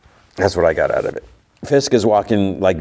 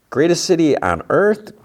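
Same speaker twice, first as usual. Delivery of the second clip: incredulous; 10 semitones higher than usual. Real, not acted. Vlogger doubting New York is the greatest city, incredulous. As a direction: pitch jumps high in mock disbelief.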